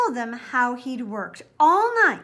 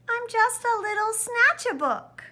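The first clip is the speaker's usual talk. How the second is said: mournful mumble; 10 semitones higher than usual. A narrator's reading. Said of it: "He mumbled with a mournful look".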